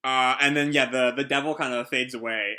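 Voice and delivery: normal talking voice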